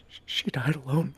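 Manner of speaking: imitating a tearful, halting read; choking back tears. Dad reading Charlotte's death to his son, choking back tears. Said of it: "I'm, like, choking back tears".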